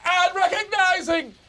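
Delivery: very high, shouted reading; shouting over headphones. Real, not acted. Trainee host reading with volume all the way up, shouting. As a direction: read it out as if you can't hear yourself. Reads aloud, unable to hear himself.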